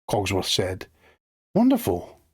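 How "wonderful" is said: high, delighted encouragement; encouraging. A narrator's reading. Belle praising Cogsworth's wish to add something, encouragingly. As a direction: cheer them on with a lifted voice. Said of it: "Belle said encouragingly".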